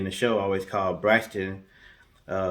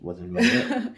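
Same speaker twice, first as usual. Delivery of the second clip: laughs out the end of the question, pitched up high; laughing; slower than usual. Real, not acted. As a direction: breaks into a laugh while doubting the story.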